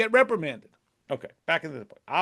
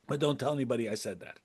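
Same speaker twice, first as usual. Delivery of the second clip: softer and fast, like sharing a secret; conspiratorial aside; 6 semitones lower, 10 dB quieter, faster than usual. Retold or imitated. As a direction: drop the voice and rush the secret.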